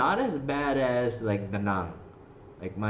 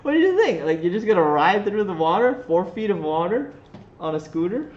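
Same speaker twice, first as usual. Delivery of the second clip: much higher and louder, disbelieving; high, loud mock scolding of a scooter rider; incredulous outburst; 9 semitones higher, 7 dB louder than usual. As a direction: throw the question out in amazement. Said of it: "And I'm like".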